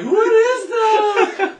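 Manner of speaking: high, loud, very slow laughing cry; mock frightened cry; scared guest. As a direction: wail it like a spooked guest. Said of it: "they would be like".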